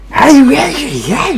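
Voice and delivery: very high, slow teasing whine; drawn-out mock complaint; playful fake annoyance. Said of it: "약간 짜증 섞인 말투 이렇게 좋게".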